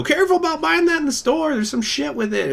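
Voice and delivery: high put-on cautionary voice; mock warning. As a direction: warn like a fussy news alarmist. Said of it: "you know".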